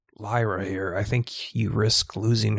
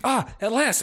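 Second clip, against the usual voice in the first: relieved exclamation; 13 semitones higher than usual. Acted. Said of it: "she's like".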